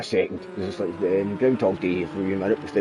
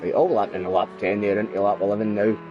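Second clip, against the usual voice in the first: ordinary talk, nothing marked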